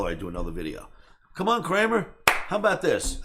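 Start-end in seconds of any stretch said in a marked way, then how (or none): 1.3-3.2 loud, high-pitched dare; retold pushy call-out; goading challenge. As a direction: egg on a rival, raised voice. Retells goading challenge in his videos.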